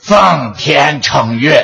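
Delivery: slow drawn out chant in a cracked voice; imitating rough voice. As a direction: copy his broken gong voice, slow. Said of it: "别学我".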